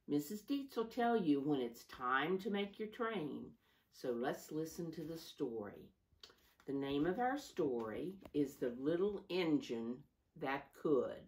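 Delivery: plain reading voice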